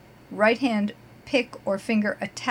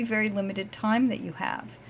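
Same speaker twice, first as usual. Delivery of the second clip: ordinary delivery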